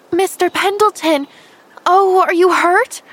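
high, loud, alarmed cry, slowing on the question; cry of dismay. Acted. Girl finding the injured man on the path, crying out in dismay. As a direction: rush to him in real alarm. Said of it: "With a cry of dismay".

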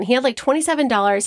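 normal talking voice

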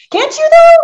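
incredulous. Real, not acted. Amazed at not speeding Paula.

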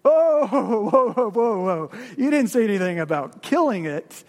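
a frightened ghost backing away; alarmed ghost. Acted. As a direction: recoil in alarm, then protest. Ghost panics at killing.

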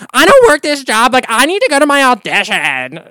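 high, loud mock sobbing plea; mock crying Sheena. Imitating the reality star's crying outburst, high and loud. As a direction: ham up her tearful rant. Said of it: "I love it when she's, like, crying".

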